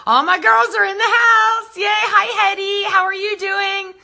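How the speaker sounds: loud, high, happy shout to the whole room; gleeful high shout of welcome; excited cheer. Real, not acted. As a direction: call it out like welcoming the gang. Greeting viewers arriving on stream.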